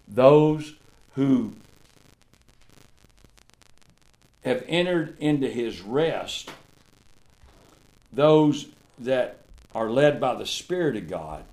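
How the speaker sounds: loud, slow, drawn-out preaching; preaching at full force. Real, not acted. Preacher to congregation on being in Christ, full-force preaching. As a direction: lean into each line like a revival sermon.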